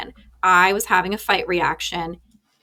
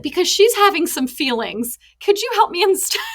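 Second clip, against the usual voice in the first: put-on higher child voice breaking into laughter; son's voice; 10 semitones higher than usual. Mom retells son asking dad for help, higher and laughing. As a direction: play the little boy, laughing as you go. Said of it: "He was like".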